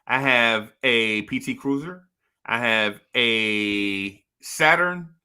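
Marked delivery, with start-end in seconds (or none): none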